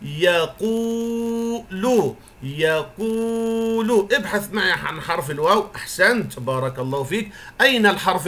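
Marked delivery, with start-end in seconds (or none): 0.0-4.1 يقول said very slowly and much higher, the و held long; long vowel demo. Shown as a demonstration. Teacher introducing the third word to pupils: يقول stretched, long و. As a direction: draw out يقول as if modelling for a class. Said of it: "ننتقل إلى الكلمة الثالثة".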